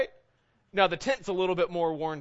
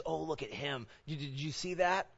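softer, nosy mock aside; judgmental gossip; 6 dB quieter than usual. Prideful onlooker pointing someone out, judgmental gossip. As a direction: gossip about him, mildly scandalized. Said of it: "It's our pride that says".

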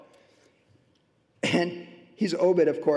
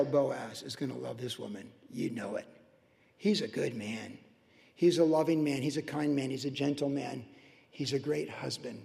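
tender hush; 6 semitones lower, 8 dB quieter than usual. Real, not acted. Tender praise of Boaz.

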